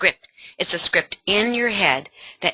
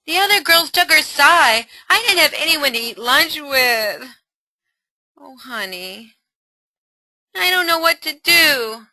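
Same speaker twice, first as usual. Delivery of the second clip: put-on higher, louder teen lament; upset daughter; 8 semitones higher, 4 dB louder than usual. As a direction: let the loneliness show.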